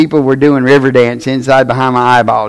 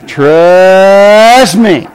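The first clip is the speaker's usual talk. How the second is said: TRUST stretched long on a rising glide; drawn-out warning; 5 dB louder than usual. Retold or imitated. Father warning his son about a relationship, drawn-out warning. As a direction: draw out TRUST slowly, then drop onto me.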